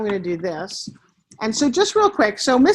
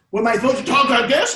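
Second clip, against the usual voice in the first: student trying out a lowered voice; put-on louder voice, testing a new register; [name]; 5 dB louder than usual. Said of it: "just try lowering your voice".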